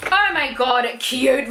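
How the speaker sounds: ordinary delivery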